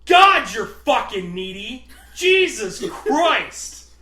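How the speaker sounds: loud, slow, drawn-out exasperated shout; mock snapping. Box guy snapping at needy coworker, mock-furious shout. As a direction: blow up in hammed-up exasperation. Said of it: "I just fucking snapped".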